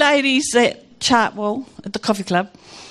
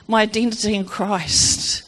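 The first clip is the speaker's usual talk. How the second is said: usual speaking voice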